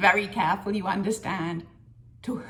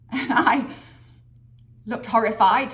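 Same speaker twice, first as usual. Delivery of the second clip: ordinary delivery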